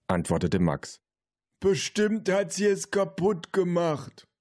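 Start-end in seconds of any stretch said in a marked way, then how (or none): 1.6-4.3 slow, high-pitched grandpa call. A narrator's reading. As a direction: call from another room, every word drawn out. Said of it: "rief der Opa". (rufender Opa, hoch und gedehnt)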